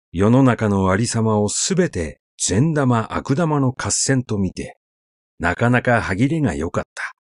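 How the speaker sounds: ordinary delivery, nothing marked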